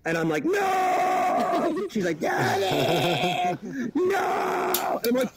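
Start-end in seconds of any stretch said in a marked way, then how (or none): 0.4-1.9 long, very high hammed-up cry; panicked mock shout. Father shouts as baby falls. 2.2-3.6 drawn-out mock child yell; imitated baby's cry. Imitates falling baby calling out. 3.9-5.0 long, very high hammed-up cry; panicked mock shout. Father shouts as baby falls.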